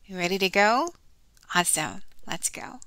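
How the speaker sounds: ordinary delivery, nothing marked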